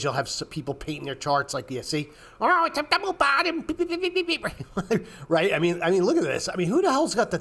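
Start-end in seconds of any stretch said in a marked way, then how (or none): 2.4-3.6 high, far-off sneering chart-guru voice; mocking chart painter. Mocking chart painters to his viewers, in a high sneering voice. 3.7-5.2 high laugh. Real, not acted. Laughs at his own parody.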